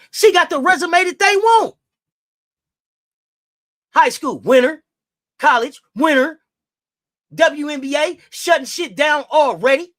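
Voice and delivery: loud, high, fired-up shouting. Real, not acted. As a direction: yell her record out. Shouting Raven's accomplishments.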